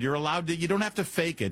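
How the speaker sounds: usual speaking voice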